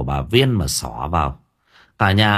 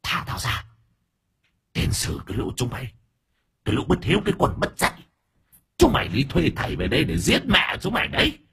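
high, soft spiteful hiss; hissing possessed old woman; 5 semitones higher, 4 dB quieter, faster than usual. A narrator's reading. Possessed old woman cursing her children, spiteful hiss. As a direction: spit out curses at the family. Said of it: "Bà già rít lên hằn học".

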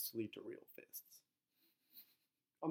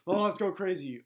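put-on drunk excitement; 5 semitones higher, 7 dB louder than usual. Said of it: "I'm at the peak of the hill".

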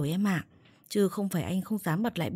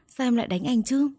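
higher, louder hurt protest; aggrieved protest; 6 semitones higher, 5 dB louder than usual. A narrator's reading. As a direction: protest to your wife, pitch jumping up. Husband protests being hit by wife. (phản đối đầy ấm ức)

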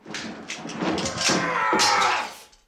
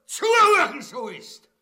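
angry command; 16 dB louder than usual. Acted. Tax collector demanding villager's attention, angry shout. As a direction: shout the order at full force. Official scolds unheeding villager.

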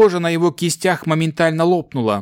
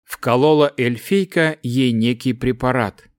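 ordinary delivery

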